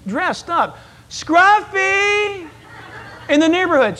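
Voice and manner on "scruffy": high, long call down the street; calling out for a lost dog. Retold or imitated. Pastor calling the lost dog down the street.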